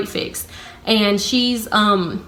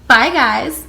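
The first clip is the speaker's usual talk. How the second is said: higher-pitched, showy farewell; pointed cheery goodbye; 4 semitones higher, 4 dB louder than usual. Retold or imitated. Leaving for break, telling other coworkers, pointedly cheery. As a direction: make a show of saying bye.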